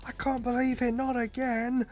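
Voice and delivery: a hammed-up panicked kid shrieking; childish scream. Mocking Zeke screaming like a child when Levi arrives, in a shrill mock wail. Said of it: "screams like a child".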